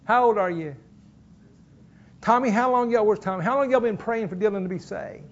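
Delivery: ordinary delivery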